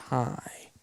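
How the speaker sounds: soft whispered hello to a dog; whispering. Real, not acted. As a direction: breathe a tiny hi. Greeting dog softly.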